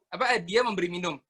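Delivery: normal talking voice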